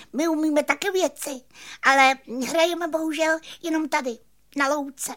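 very high, fast cricket chatter; squeaking cricket. A narrator's reading. Cricket telling the magician about their playing, high fast squeaking. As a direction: squeak it out quickly. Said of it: "kníkl Cvrček".

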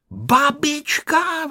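put-on high, drawn-out little boy's exclamation; excited cry. A narrator's reading. Boy spots news of his grandmother, excited cry. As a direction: cry it out high, stretching the word. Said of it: "vykřikl Honzík".

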